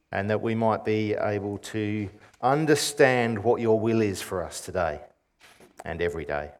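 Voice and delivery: soft, low prayer voice. Real, not acted. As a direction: pray quietly and reverently. Opening prayer.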